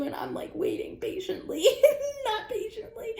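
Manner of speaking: high giggly words; laughing at her own impatience; laughing through it. Real, not acted. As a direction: break into a laugh mid-line.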